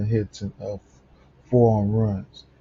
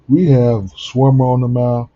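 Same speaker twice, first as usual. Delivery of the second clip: louder, slower, stretched through a yawn; sleepy yawn spilling into the lineup read; yawning while talking; 3 semitones higher, 10 dB louder than usual. Real, not acted. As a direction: stretch the words as a yawn takes over. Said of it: "if I yawn one more time".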